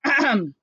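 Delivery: teacher clears throat for attention; teacher quieting the class, clearing her throat. Acted. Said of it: "cleared their throat".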